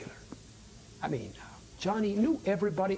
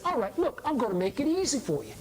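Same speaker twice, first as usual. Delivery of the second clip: taunting offer; 7 semitones higher than usual. Acted. Guy taunts Johnny to hit him.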